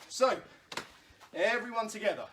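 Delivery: slightly out of breath. Real, not acted. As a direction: talk short of breath. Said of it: "I'm out of breath".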